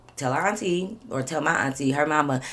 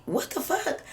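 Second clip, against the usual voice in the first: high-pitched disbelief; retold shock; 13 semitones higher, 4 dB quieter, slower than usual. Retelling her shock on walking in.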